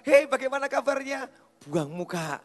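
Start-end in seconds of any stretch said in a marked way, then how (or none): 0.1-1.3 high, loud call of hello; hearty greeting. Retold or imitated. Pastor greets the snubber on meeting again, hearty greeting. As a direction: hail someone eagerly, loud and high.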